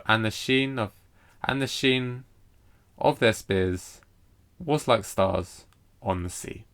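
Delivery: slow, foot by foot, pausing after "sheen", "spears", "stars"; measured verse, stretching "sheen", "spears", "stars", "sea"; anapestic stress demo. Shown as a demonstration. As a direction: break the line into four beats ending on "sea". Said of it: "got four lots of anapestic".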